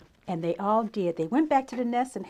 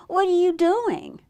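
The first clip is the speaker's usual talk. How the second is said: high-pitched, friendly question; friendly Beaver; 7 semitones higher, 4 dB louder, slower than usual. A narrator's reading. Beaver greets Bird and asks, high friendly voice. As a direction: sing it up high as a storybook beaver. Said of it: "said Beaver".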